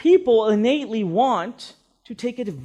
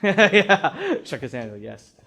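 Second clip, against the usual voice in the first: chuckling reply; amused at the student's answer; laughing; 3 semitones lower, 3 dB louder, much slower than usual. Real, not acted. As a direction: laugh through it. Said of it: "laughs at a student".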